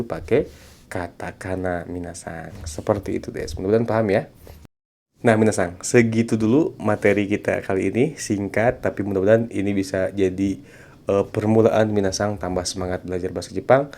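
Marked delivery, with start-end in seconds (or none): none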